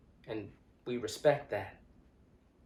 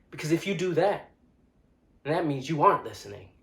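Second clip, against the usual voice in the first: loud, raised-pitch confrontation; heated, insistent and louder; impassioned rebuke; 3 semitones higher, 5 dB louder than usual. Acted. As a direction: tell them off forcefully.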